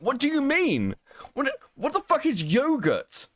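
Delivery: high, indignant disbelief; incredulous outrage. Real, not acted. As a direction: pitch up, can't believe it. Indignant over yogurt pronunciation.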